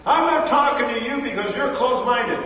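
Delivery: high, loud mock-enraged shout; retold angry shout. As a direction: shout it like someone storming off. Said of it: "he shouts at me".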